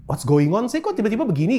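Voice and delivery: puzzled, pitch rising high; confused. Retold or imitated. Congregation wondering why the pastor cries, rising puzzled.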